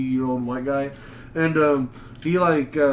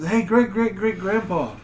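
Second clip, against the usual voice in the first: higher-pitched mock greeting to an old man; acted bystander; 5 semitones higher than usual. Someone approaches a Confederate great grandpa, acted greeting. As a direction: call over to grandpa, playfully. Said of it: "someone goes up".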